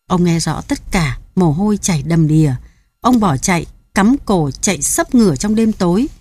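usual speaking voice

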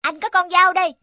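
put-on high, bold young boy; brave boy voice. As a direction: reassure the little sister, boldly. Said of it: "cố hết sức bảo vệ em mình". (giọng cậu bé dũng cảm)

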